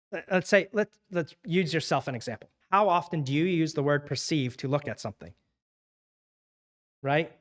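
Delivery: normal talking voice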